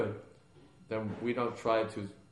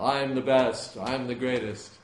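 loud, higher mock bragging; boastful devotee; 4 semitones higher, 11 dB louder, slower than usual. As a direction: crow it like a self-proclaimed champion. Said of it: "not that the devotee with the biggest pile".

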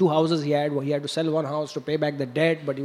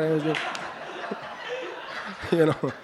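ordinary delivery

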